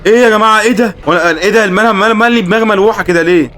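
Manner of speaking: reacting to a horror reveal; stunned high-pitched outcry; shocked outcry. Real, not acted. Shocked by creepy scene.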